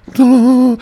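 high, loud goofy "Duh"; mock dumb reply. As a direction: toss it out high and loud like a cartoon. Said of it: "YouTube's like".

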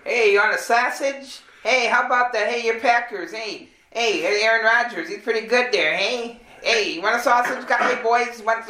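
high, chummy Midwest hot dog vendor voice; upbeat Midwestern vendor accent, pitched high; typical Wisconsin vendor. Retold or imitated. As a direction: hawk sausages like a Wisconsin local. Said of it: "your typical".

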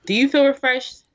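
high, loud question to the audience; lively host question. Acted. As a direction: ask it brightly to the crowd.

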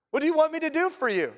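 Jesus laughing as he asks the blind man what he wants; amused asking. Retold or imitated. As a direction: tease the question out, chuckling, in front of everyone. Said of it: "I wonder what he wants".

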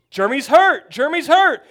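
frantic sideline yell; panicked shout. Retold or imitated. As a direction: shout it across the field in a panic. Coach panics when player hurt.

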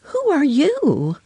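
put-on high, curious girl voice; little girl's question. A narrator's reading. Lucy questions a stranger.

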